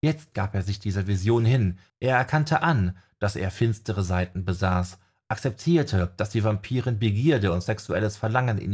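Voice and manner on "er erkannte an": usual speaking voice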